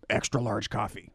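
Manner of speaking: low, slow Batman growl line. Acted. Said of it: "here's a line".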